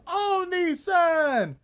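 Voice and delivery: long, high, slow Oni-san with a falling end; drawn-out anime call. Shown as a demonstration. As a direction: stretch out Oni-san slowly, sing-song.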